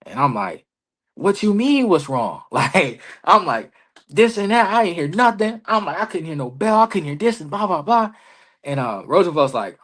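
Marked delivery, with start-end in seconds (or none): 1.2-2.4 retold heated comeback, high at the start. Wrestler answering Roosevelt backstage, heated retort. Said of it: "I'm heated". 4.1-8.1 high-pitched retold rant; heated. Wrestler venting to Roosevelt about not hearing the bell, heated. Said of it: "I'm heated".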